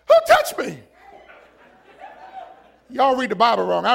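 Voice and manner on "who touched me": high, startled question; startled Jesus. Acted. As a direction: whip round in sudden surprise. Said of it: "That's how he said it".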